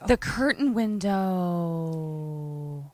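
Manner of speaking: long drawn-out last word; mock dramatic echo. Co-host echoing the storyteller's phrase, mock drama. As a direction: stretch it out like a mock-ominous echo.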